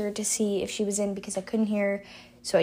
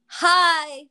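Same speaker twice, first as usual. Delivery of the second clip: loud, high hi; bright greeting; 5 semitones higher, 7 dB louder than usual. Real, not acted. Podcast intro greeting.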